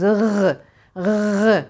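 "R" as a throaty gargled ğ sound; isolated guttural "ğı"; voiced soft G. Shown as a demonstration. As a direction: push a soft G from the back of the throat. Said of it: "yumuşak G'yi çıkarmak istesek şöyle çıkarız".

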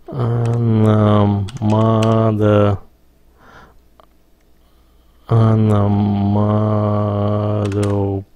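very slow, drawn-out syllables; slow dictation. Real, not acted. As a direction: spell it out by sound for a machine. Dictating slowly to spell checker.

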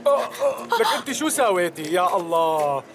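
sudden high yelp of pain; pained outburst. Acted. Beard pulled off, pain.

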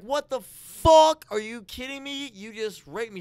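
high, loud outraged yell, slow at first; retold panic, shouted high; freaking out. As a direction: yell it like you just got ambushed. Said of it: "So I'm freaking out".

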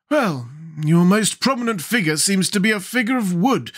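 high-pitched, admonishing Scarecrow; warning. A narrator's reading. Scarecrow cautions brainless Jack, warningly. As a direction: caution primly in a high character voice. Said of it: "suggested the Scarecrow warningly".

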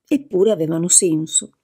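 ordinary delivery

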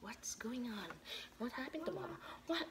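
very soft, whispered question; barely voiced, low aside; hushed whisper. Real, not acted. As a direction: ask it under your breath. Hushed aside while tired.

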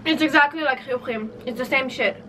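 chewing while talking; covering mouth mid-chew; mouth full. Real, not acted. Seen 0: Talks with a hand over her mouth right after biting the biscuit.

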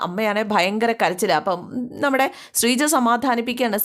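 usual speaking voice

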